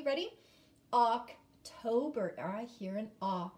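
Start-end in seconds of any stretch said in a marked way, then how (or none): 0.9-2.3 "October" drawn out slowly, each syllable clear; slow modelling. Shown as a demonstration. Teacher says the word slowly with the class. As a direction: stretch out "October" for the kids. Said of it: "Can you say October with me?".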